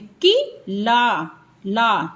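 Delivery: की ला drawn out slowly; slow sounding-out; slow sounded-out syllables. Shown as a demonstration. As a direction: sound out की ला before blending. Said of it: "आ का sound भी आएगा इसमें".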